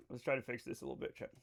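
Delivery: softly muttered repeat while tinkering with settings; muttered to self. Shown as a demonstration. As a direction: mutter it fast while busy fixing something. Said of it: "All right, let's try to fix this".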